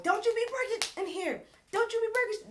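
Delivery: high-pitched, mock furious warning; full-on scolding. Amara hearing things get broken, going off. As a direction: imitate an outraged woman going off. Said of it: "she going a full-fledged mode".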